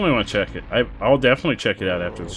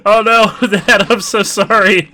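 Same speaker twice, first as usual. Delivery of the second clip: laughing apology; 8 semitones higher, 8 dB louder, slower than usual. Real, not acted.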